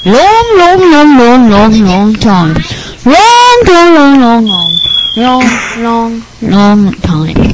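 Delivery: hammed-up sing-song "long, long, long", falling in steps; drawn-out repetition. Nerd guest stresses the long flight, hammed-up wail.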